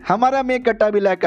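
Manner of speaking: ordinary delivery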